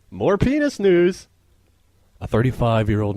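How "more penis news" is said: higher, slower announcer read; mock news intro. Host opening the next item, a mock news headline.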